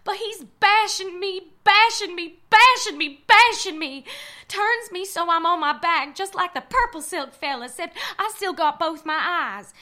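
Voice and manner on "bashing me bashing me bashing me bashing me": building intensity. Acted. Narrator relives the beating.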